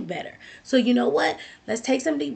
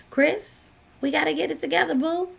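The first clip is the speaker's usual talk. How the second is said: higher-pitched, coaxing talk to oneself; self pep talk; 6 semitones higher than usual. Acted. Talking to yourself in the mirror, coaxing pep talk. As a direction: nudge yourself along like a fond friend. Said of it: "Look at yourself in the mirror and say".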